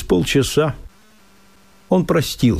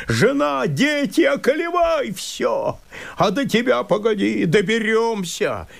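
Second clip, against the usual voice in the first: очень высокий, исступлённый надрывный крик; кричи, будто терять уже нечего; отчаянная ярость; 10 semitones higher than usual. Acted. (мужик в исступлении кричит на лесника)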